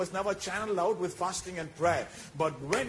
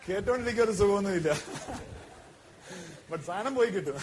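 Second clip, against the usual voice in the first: laughing while talking; much faster than usual. Real, not acted. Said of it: "laughing through the remark".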